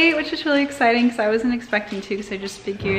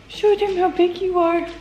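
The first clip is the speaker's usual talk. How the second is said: cooing at dog; 9 semitones higher than usual. Real, not acted.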